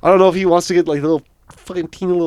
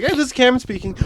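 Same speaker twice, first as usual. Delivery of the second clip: higher, lightly put-on phone hello; a hint of work phone voice; habitual phone greeting; 6 semitones higher, 4 dB quieter, faster than usual. Shown as a demonstration. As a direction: demonstrate a rote call introduction. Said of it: "I'll call someone and I'm just like".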